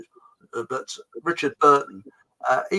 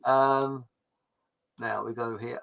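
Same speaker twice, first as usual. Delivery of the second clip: normal talking voice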